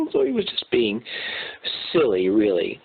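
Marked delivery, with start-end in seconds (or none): none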